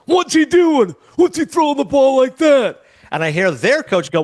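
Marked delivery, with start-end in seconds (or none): 0.1-2.8 put-on high, loud, heckling old man from the stands; a cranky old geezer yelling across the field, high and loud; older man's voice. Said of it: "all I can hear is this older man's voice".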